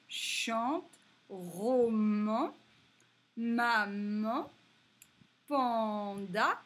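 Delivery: put-on word list read out loud for children; teacher's repeat list. Shown as a demonstration. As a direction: call out each word clearly for the class. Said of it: "Je répète".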